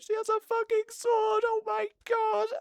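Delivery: high, excited squeal from the room; squealing crowd. Pretend. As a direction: shriek it like an amazed crowd. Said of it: "the whole room's just gonna be like".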